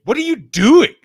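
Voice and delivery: high, loud teasing outrage; mock outrage. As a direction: play shocked disbelief.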